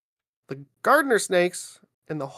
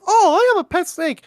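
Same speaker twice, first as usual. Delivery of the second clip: high, louder gushing pet owner; mock-excited, pitched way up; mocking imitation; 11 semitones higher, 5 dB louder than usual. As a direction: parody a delighted snake lover.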